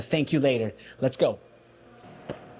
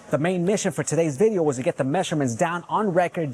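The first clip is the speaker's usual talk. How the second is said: ordinary talk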